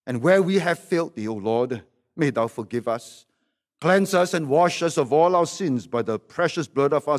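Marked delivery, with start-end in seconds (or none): none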